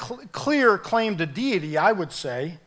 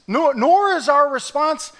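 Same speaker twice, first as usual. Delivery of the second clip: ordinary talk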